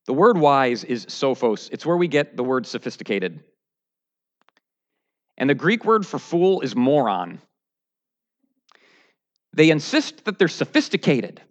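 usual speaking voice

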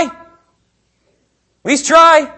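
"At least try" stretched very slowly, high and loud; drawn-out plea. Shown as a demonstration. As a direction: drag out "try" like a pleading sigh. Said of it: "repeated, drawn out".

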